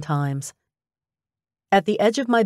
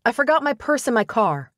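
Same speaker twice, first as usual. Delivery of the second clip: higher-pitched offhand call; calling over shoulder; 6 semitones higher than usual. A narrator's reading. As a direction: call back an excuse while leaving. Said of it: "I called over my shoulder".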